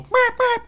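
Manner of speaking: two high turkey yelps; imitated hen call. Shown as a demonstration. Guide showing the call he will make, high yelping. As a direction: squeak out a quick pair of hen calls. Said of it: "I'm gonna go".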